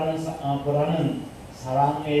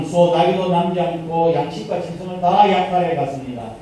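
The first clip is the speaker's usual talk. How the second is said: ordinary talk, nothing marked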